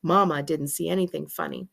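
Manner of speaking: plain reading voice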